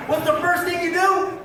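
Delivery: ordinary delivery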